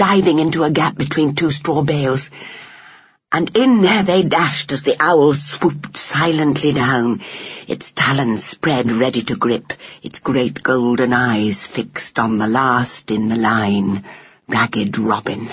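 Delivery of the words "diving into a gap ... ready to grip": ordinary delivery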